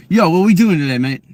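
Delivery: loud, high street greeting slipping toward British. Shown as a demonstration.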